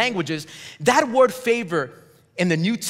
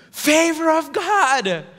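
mock awe; 8 semitones higher, slower than usual. Preacher's sarcastic punchline.

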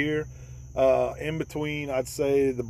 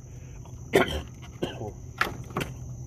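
hard coughing fit; choking cough; 5 dB quieter than usual. Real, not acted. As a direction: cough on a swallowed gnat. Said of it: "excuse me, guys".

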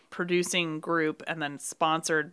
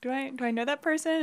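high, puzzled aside; mock puzzlement; 8 semitones higher than usual. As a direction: wonder aloud in a higher voice. Voices own puzzled reaction to greeting.

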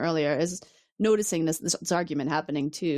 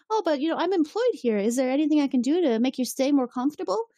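lightly voiced, higher woman's offer; eager hostess; 8 semitones higher than usual. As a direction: pitch it up as a helpful hostess. Voices the woman offering her services.

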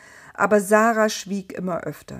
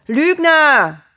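much higher, much louder cry of "liar"; full shout of betrayal at night; furious shout; 8 semitones higher, 11 dB louder than usual. Acted. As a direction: hurl the word at the voice. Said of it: "schrie Abraham".

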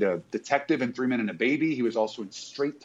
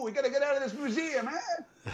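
much higher, laughing mock Brooklyn security guard; Brooklyn accent; 3 dB quieter than usual. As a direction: imitate a Brooklyn museum guard, giggling. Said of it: "he's got a Brooklyn accent too".

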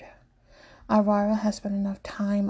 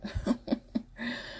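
amused chuckle; 3 semitones higher, 8 dB quieter than usual. A narrator's reading. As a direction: chuckle softly at him. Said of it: "Hendrix chuckles".

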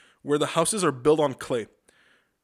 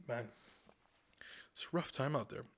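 normal talking voice

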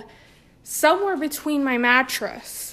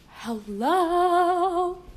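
echo test; 7 semitones higher than usual. Real, not acted. Testing the room acoustics.

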